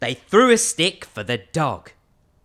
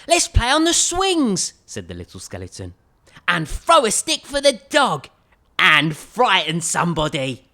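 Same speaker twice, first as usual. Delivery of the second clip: little skeleton voice; 2 semitones higher, 5 dB louder than usual. Acted. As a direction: suggest the fun plan like an eager child.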